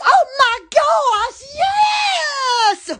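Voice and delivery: high, drawn-out excited cry. Real, not acted. Vlogger cheering about her Facebook, high excited cry. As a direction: cry out in delight.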